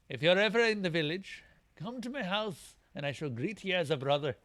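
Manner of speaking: boastful Scottish warrior, loud and exultant; triumphant game warrior with a put-on Scottish accent. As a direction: crow over the victory in a Scottish brogue. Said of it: "these guys aren't Scottish".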